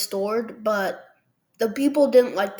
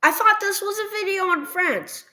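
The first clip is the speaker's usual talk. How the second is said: much higher, fast and puzzled viewer voice; mock viewer; 10 semitones higher than usual. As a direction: rattle off the objection. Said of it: "Now you might be saying".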